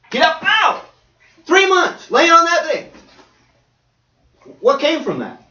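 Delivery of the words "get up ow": mock pain. Acting out back pain.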